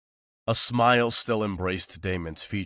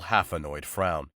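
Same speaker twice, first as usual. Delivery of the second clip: usual speaking voice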